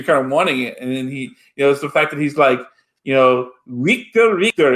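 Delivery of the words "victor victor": imitating Chekhov's thick Russian accent, high-pitched. Chekhov to the ship's computer, mock thick Russian accent. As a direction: say Victor the way a Russian Chekhov would. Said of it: "that thick Russian accent".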